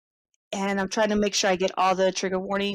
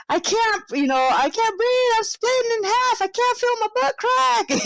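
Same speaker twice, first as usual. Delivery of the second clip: high-pitched mock hysteria; panicked caller; 11 semitones higher than usual. Stoned man begging nine one one for help, mock hysterical. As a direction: wail it in rising, exaggerated panic. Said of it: "a guy calling nine one one".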